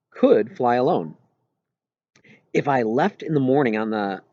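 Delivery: ordinary delivery, nothing marked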